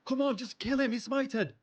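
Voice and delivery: high-pitched eager urging; excited urging. Real, not acted. Player urging his game for the kill, high and eager. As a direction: push the game to land the kill.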